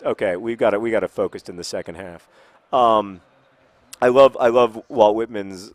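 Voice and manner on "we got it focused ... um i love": normal talking voice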